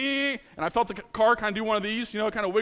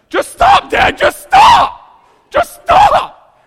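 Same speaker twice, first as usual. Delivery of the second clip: high frantic yelling, begging; panicked shout; 14 dB louder, much slower than usual. Retold or imitated. Son in the back seat yelling at dad to stop, panicked shout. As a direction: scream at dad to stop right now.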